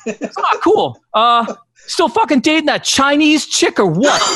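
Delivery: high, slow, clueless Boston dude; Boston bar buddy. Retold or imitated. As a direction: drag it out high like a tactless pal. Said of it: "I'm like".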